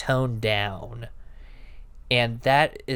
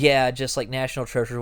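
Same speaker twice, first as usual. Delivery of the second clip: ordinary delivery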